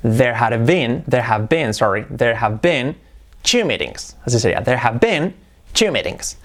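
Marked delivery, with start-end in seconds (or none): none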